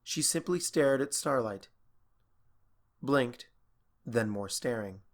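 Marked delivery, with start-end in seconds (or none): none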